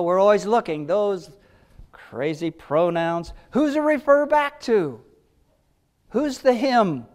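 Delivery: ordinary talk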